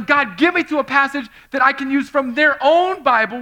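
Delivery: loud, high-pitched preaching drive; full-force preaching. Acted. Preacher driving the point home to the congregation, loud and high. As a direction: preach it hard to the room.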